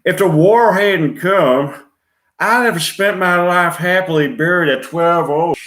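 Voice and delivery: high, loud Southern drawl; Southern accent. Acted. Player acting Gone with the Wind, Southern accent. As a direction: act it big in a Southern accent. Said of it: "Scene one in a Southern accent".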